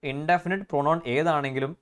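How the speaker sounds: ordinary talk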